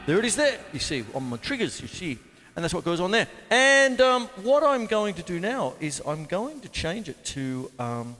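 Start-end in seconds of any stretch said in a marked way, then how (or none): none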